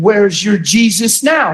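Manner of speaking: normal talking voice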